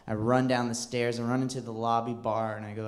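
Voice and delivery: usual speaking voice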